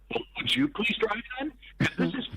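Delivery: high, pleading mock plea; lightly imitating a pleading passenger, pitched high; mock pleading wife. Suggested line, mocking wife's driver.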